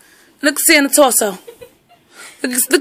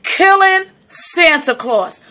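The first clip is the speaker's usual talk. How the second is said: ordinary delivery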